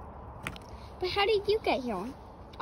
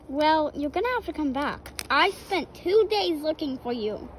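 usual speaking voice

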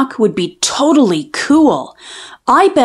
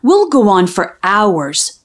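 ordinary delivery

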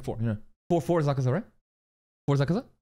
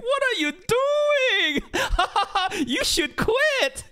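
high, loud, slow scolding teacher voice; mock roasting teacher; 4 dB louder, much slower than usual. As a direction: imitate a teacher tearing into a student. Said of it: "he just straight up roasted you".

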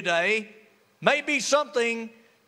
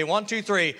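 usual speaking voice

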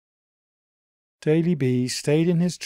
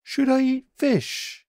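high, small puzzled bunny voice; a high, wondering little creature; Daily B's voice; 4 dB louder than usual. A narrator's reading.

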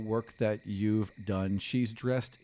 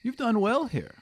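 a lightly voiced young elf, higher; gently higher stranger's greeting; elf's voice; 12 semitones higher, 5 dB louder than usual. GM voicing the elf greeting the player, lightly higher.

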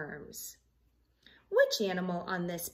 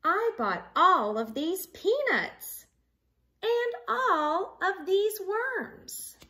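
rising higher with excitement; excited storybook voice; 9 semitones higher, 4 dB louder than usual. Acted. Teacher reading the rabbit's happy news to the class, excited and higher. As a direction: build up the lunch-party news.

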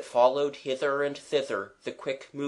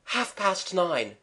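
slow, higher, mildly winded; barely enough breath; 6 semitones higher, slower than usual. A narrator's reading. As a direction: force it out with little breath left. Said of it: "Gerald had just breath to say".

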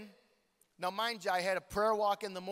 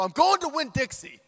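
insistent; 9 semitones higher, 9 dB louder than usual. Retold or imitated. Insisting to friend Joe.

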